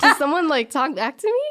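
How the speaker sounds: hammed-up outraged doctor; mock shocked. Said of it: "she was shocked".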